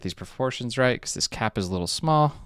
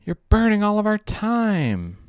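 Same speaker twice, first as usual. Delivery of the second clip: higher, louder whine at the computer; exasperated complaint; 8 semitones higher, 5 dB louder than usual. Real, not acted. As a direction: whine it out in frustration. Complaining at slow software.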